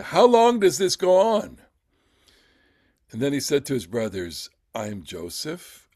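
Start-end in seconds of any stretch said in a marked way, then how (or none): 0.0-1.6 higher, louder mock exasperation; impatient audience. Impatient frustrated audience, acted. 4.7-5.9 soft, lower-pitched declaration; hushed reveal. Acted. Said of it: "don't know what the tone".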